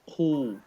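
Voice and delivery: Khu said heavy with a full mouth; round, full-mouthed Khu. Shown as a demonstration. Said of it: "still heavy".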